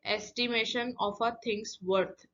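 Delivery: slow, deliberate quiz-question delivery; slow question reading. Shown as a demonstration. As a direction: read the clue slowly and clearly for the class. Teacher reading the quiz question aloud.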